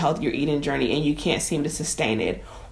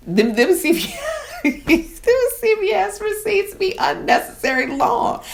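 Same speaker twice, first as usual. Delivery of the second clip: much higher, slow, laughing through the words; laughing high mock voice. Joking to viewers about endless CVS receipts, high laughing voice. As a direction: squeal it out, cracking yourself up.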